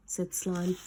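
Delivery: ordinary delivery, nothing marked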